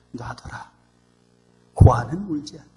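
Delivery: soft, hushed grave remark; quiet uncle's remark. Retold or imitated. Orphanage director quietly telling brother. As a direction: say it low under your breath. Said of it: "하시는 말씀이".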